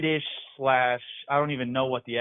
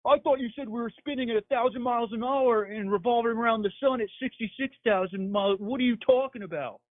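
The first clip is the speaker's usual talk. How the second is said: higher, scoffing mock voice; mocking coworkers; 9 semitones higher than usual. Caller mimicking coworkers who scoffed at his science. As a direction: play the doubters, incredulous and sneering. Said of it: "And then they were like".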